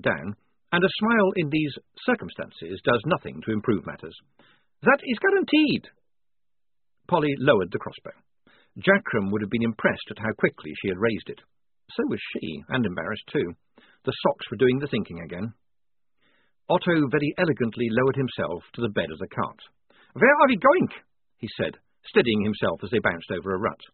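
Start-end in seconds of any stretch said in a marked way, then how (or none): 4.8-5.9 high, loud, cheery vampire; vampire reassuring the soldier, high and cheerful. A narrator's reading. Vampire Otto voice. 20.1-20.9 high, loud, cheery vampire; vampire reassuring the soldier, high and cheerful. A narrator's reading. Vampire Otto voice.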